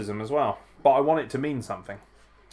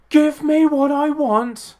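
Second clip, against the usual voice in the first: much higher, louder and slow, a demanding bellow; mock wrestler demand; 11 dB louder, much slower than usual. Wrestler in the match demanding his way, loud mock bellow. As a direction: ham up the wrestler demanding what he wants.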